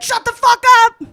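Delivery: high, loud mock-furious yell; shouted retort. Comic telling off a catcaller, shouted retort. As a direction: cut someone off with a big retold shout.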